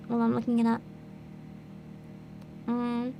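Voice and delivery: usual speaking voice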